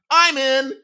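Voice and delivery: bright high I'm in; quick eager agreement; eager yes. Retold or imitated. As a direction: jump at the chance. Eager kid signing up.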